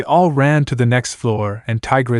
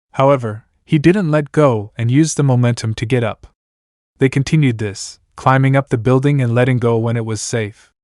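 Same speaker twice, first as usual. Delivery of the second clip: ordinary delivery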